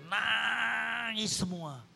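long, swelling emphasis on the weeping; drawn-out word. Retold or imitated. Preacher recalls worshippers collapsed on the floor, drawn-out and rising. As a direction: stretch the first word into a long rising wail-like hold.

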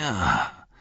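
drawn-out word sinking into a whisper; low, half-whispered bitter acknowledgement; astonished, grudging respect. A narrator's reading. Fallen foe admits the Halfling has grown, grudging respect. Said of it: "Erstaunen, vermischt mit Respekt und Hass".

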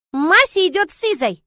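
put-on a toddler announcing her plan; confident little girl. Little Sonya insists on going with Liza, high childish voice. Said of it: "повторила девочка".

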